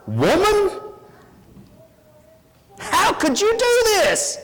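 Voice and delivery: accusing crowd. Acted. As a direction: condemn her as a lawbreaker. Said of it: "can't you just hear the crowd of men".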